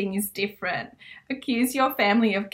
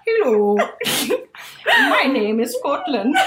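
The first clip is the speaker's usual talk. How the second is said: put-on a light stab at a Scottish accent; loudly announcing herself as Scotland; mock Scottish; 3 semitones higher, 3 dB louder than usual. A mock Scottish accent, done lightly. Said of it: "I did it".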